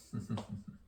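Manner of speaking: short, soft closed chuckle. Real, not acted. Poet amused by own cat line, soft chuckle. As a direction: quietly chuckle at your own joke.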